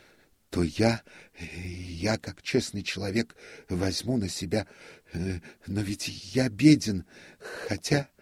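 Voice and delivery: ordinary delivery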